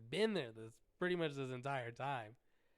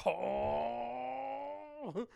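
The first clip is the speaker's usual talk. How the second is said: high, loud burst of excitement; excited cry; excited yelp; 10 semitones higher, 7 dB louder than usual. Retold or imitated. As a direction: shout it out thrilled. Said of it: "I got excited".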